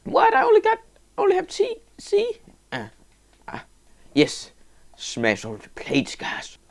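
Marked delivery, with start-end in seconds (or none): 0.0-2.4 high, fast indignant squeak; squeaky dismay. Pretend. Gamer squeaking in dismay at a C grade. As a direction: squeak in disbelief.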